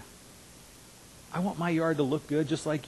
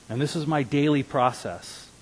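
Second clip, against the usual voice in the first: normal talking voice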